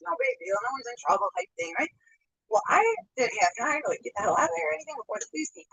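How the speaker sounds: normal talking voice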